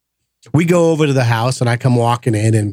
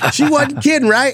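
laughing; 13 semitones higher than usual. Real, not acted. Still laughing at the memory.

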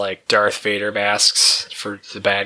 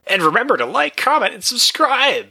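high, hyped YouTuber sign-off; YouTuber outro; 12 semitones higher than usual. Pretend.

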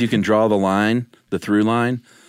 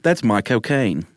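Michael Caine accent; slower than usual. Shown as a demonstration. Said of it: "That's pretty good".

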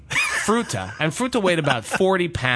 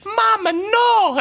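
very high, loud, drawn-out mock protest; mock squeal; 5 dB louder, much slower than usual. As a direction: imitate an embarrassed daughter wailing at her mother. Acts out embarrassed daughter's protest.

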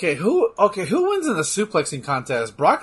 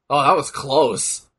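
normal talking voice

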